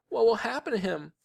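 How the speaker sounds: put-on high, anxious girl's wail; crying. A narrator's reading. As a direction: ask fearfully in a high voice. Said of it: "Sheila cried".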